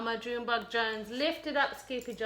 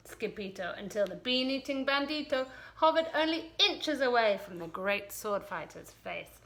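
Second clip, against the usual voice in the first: ordinary delivery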